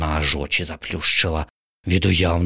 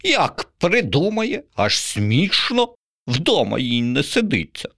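pigeon voice; 9 semitones higher than usual. Acted. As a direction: laugh off the crow's whim. Pigeon character voice.

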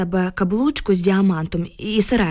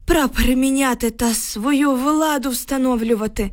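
higher-pitched worried villager's voice; 4 semitones higher than usual. Acted. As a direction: report the troops' arrival in a raised pitch. Villager reports approaching troops in dialogue. (стривожений селянський голос, вищий за звичайний)

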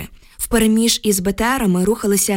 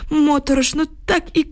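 put-on higher-pitched voice of an old villager; old village man; 8 semitones higher than usual. A narrator's reading. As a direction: tell it as a worried grandpa, pitched up. Old villager recounts what he saw.

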